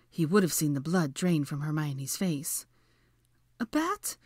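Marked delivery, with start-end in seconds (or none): 3.6-4.2 high, frightened question; fearful. A narrator's reading. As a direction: squeak it out, afraid to hear the answer. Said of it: "she whispered fearfully".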